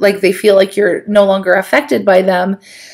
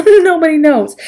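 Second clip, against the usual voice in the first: laughing; 8 semitones higher, 3 dB louder than usual. Acted. Repeats with laugh.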